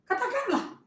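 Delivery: high, rude one-word retort. Acted. Saul answering the prophet rudely, high curt retort. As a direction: spit it back rudely, high. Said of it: "Orang kalau ngomong kayak gitu kan udah kasar ya".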